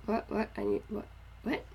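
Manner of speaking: normal talking voice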